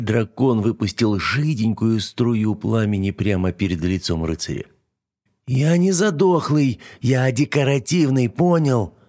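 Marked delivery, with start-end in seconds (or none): 5.5-8.9 higher, slow offended dragon; squeaky dragon. A narrator's reading. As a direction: huff like a touchy little dragon. Dragon character voice.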